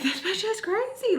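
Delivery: very high-pitched disbelief; mock alarmed thought. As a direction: squeal it like a stunned inner reaction. Retold thought about opponent.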